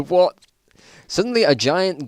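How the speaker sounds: laughing surprise. Real, not acted. Laughing surprise at own story.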